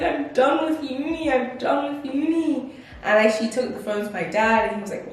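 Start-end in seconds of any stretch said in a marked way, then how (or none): 0.0-2.7 crying. Retold or imitated.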